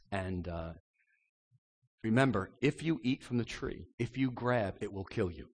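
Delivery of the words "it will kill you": usual speaking voice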